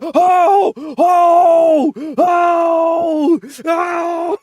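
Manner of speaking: high shouted oh oh oh; mock shouting. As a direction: yell it out in shock. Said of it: "He said".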